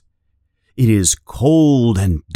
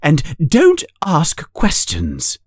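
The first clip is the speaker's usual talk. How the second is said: higher, curt aunt voice; stern aunt; 7 semitones higher than usual. A narrator's reading. Aunt cutting off Harry's questions, curt. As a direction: shut the question down.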